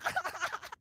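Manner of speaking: sudden high laugh; burst of laughter. Real, not acted. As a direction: laugh out at the wipe. Reacts to a kill.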